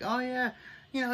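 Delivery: high, breezy fake innocence; playing dumb. Wanda covering for Agnes, playing dumb. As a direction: brush it off as if nothing is odd. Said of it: "Wanda tries to play dumb".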